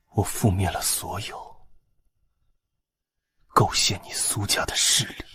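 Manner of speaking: soft, half-whispered words; grim hushed confession. Acted. Prince telling his beloved of avenging her family, hushed.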